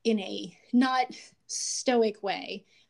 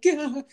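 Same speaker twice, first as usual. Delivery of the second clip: mock frustrated gah; 5 semitones higher, 7 dB louder than usual. Mock overwhelmed outburst.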